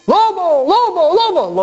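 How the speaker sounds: very high repeated mock alarm; grito de alarma. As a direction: yell wolf over and over like the boy. Boy cries wolf in story. (gritos agudos de falsa alarma)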